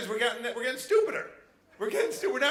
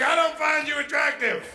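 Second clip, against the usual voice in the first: husband; 7 semitones higher, 5 dB louder than usual. Retold or imitated. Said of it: "He's like".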